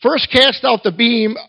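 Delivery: measured, emphatic King James reading; Scripture reading. Acted. Preacher reads Matthew seven to the congregation, proclaiming. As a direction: proclaim the verses with weight.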